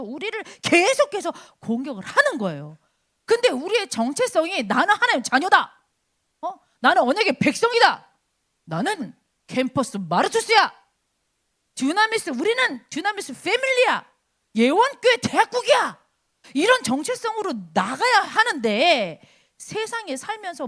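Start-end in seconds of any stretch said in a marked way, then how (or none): 4.6-16.0 raised, louder proclamation of identity; emphatic declaration. Acted. Believer declares who they are in God, emphatic. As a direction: declare it like a rallying cry.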